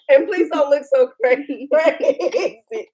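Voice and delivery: words breaking up into laughter; laughing plea. Real, not acted. Mother teasing daughter about looking crazy on camera, laughing. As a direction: crack up midway through the last word.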